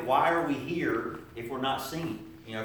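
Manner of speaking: ordinary delivery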